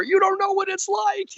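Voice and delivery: husband yelling back at his wife, whiny and loud; whiny protest. Retold or imitated. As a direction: cry out like a cornered husband.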